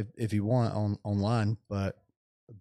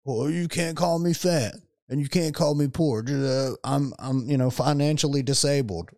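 mocking whiny fans; 5 semitones higher, 3 dB louder than usual. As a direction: whine it like a touchy fan mocked for being fat. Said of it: "They don't wanna".

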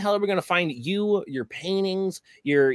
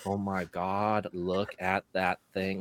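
softer, dreamy gushing admirer; mock swooning admirer; 5 semitones lower, 5 dB quieter than usual. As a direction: gush over it like a lovestruck fan.

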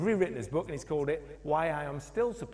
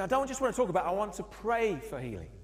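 higher, louder firm declaration; emphatic insistence; 7 semitones higher, 4 dB louder than usual. Retold or imitated. Guest speaker insisting he will pray, emphatic and loud. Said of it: "He said".

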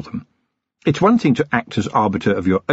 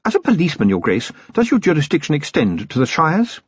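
icily; 7 semitones higher than usual. A narrator's reading. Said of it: "and said, icily".

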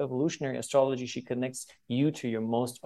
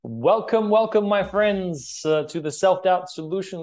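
excited welcome; 6 semitones higher, 6 dB louder than usual. Acted. Host's big show opening.

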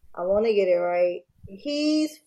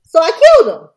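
blunt loud confession; 8 semitones higher, 12 dB louder than usual. Retold or imitated. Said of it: "He's like".

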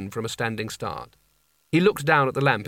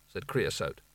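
ordinary delivery, nothing marked

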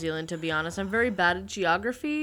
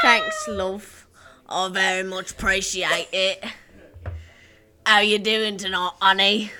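put-on British accent; 7 dB louder than usual. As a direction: play a cheeky British charmer with a British accent. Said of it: "British is the one that I prefer to do".

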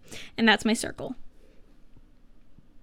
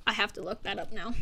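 ordinary talk

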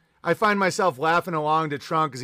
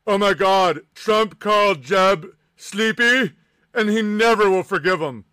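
mock wealth advisor; 4 dB louder than usual. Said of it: "You're like".

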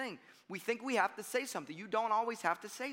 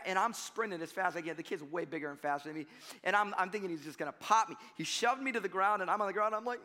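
ordinary talk

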